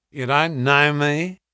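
slow, sly sailor's question; old sailor. A narrator's reading. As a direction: ask it slowly with a knowing grin. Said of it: "he asked".

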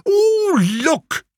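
high, squealing mock alarm; a suitor parodying the prophet; mocking cry. A narrator's reading. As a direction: ham up the seer's panic to mock him. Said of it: "he cried".

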